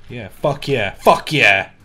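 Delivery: loud cheer building to a shout; real victory shout, punchy and loud; triumphant cheering. Real, not acted. Celebrating a success in game.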